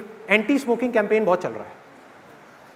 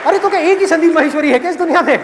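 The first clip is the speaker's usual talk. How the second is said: high, louder huffy comeback; indignant retort; 6 semitones higher, 4 dB louder than usual. Acted. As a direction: snap back in disbelief. Daughter retorts to father. (पिता को ऊँची आवाज़ में तुनककर जवाब देती बेटी)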